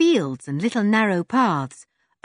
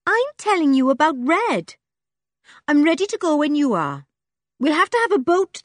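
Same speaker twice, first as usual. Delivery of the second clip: put-on higher, blunt street-kid voice; a scruffy girl who trusts nobody; Joe, urchin girl; 9 semitones higher, 4 dB louder than usual. A narrator's reading. Joe telling the children about Red, in a blunt higher voice.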